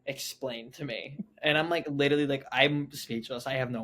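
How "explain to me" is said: slow, soft, controlled demand; quietly measured parental command; stern mom. Retold or imitated. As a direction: imitate a cross mother, quiet and slow. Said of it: "arms crossed. She's like".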